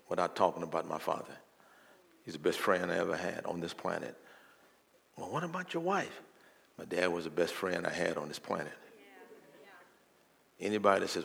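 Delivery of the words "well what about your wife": high, soft voice of a challenger; imagined questioner. Acted. Someone challenging the preacher about his best friend, high soft questioner. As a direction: put the question in a small, high voice.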